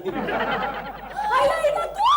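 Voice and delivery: high, drawn-out squeal of surprise; high-pitched, stretched-out astonished greeting; surprised recognition. Acted. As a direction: squeal on spotting an old friend. Two maids recognise each other.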